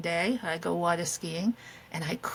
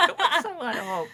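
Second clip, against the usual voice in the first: laughing; 5 semitones higher, faster than usual. Real, not acted. Laughing repeat of partner.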